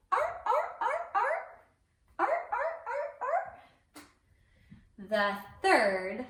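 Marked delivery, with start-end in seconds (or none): 0.1-3.4 seal barking. Shown as a demonstration. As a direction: bark like a playful seal. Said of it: "Can you make that sound at home".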